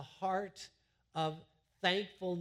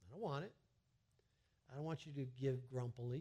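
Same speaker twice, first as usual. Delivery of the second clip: God voiced softly; 3 semitones lower, 7 dB quieter, faster than usual. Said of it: "God says".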